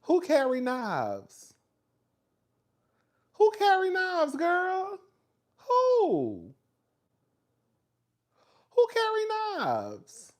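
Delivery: high-pitched, drawn-out disbelief; slow, very high questioning, falling at the end; incredulous. Real, not acted. Streamer reacting to a claim about knife-carrying rats, incredulous.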